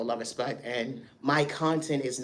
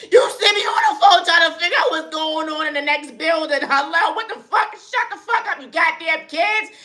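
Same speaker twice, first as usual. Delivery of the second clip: much higher and louder furious mock shout; yelling parent; 14 semitones higher, 8 dB louder than usual. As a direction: bark at the kids mid phone call. Parent on phone yelling at kids.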